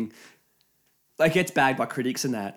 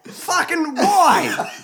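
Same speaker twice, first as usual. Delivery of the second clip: laughing; 5 dB louder, much slower than usual. Real, not acted. Laughing at own heartlessness.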